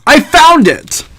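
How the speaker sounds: high, drawn-out cry of discovery; exclaimed discovery. Acted. Narrator quotes the philosopher's last words, exclaimed. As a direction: call it out high and slow, triumphant.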